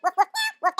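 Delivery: loud, punchy woofs; playful dog impression, loud short barks; dog barking. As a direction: bark out rapid woofs like a dog. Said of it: "you can talk like a dog".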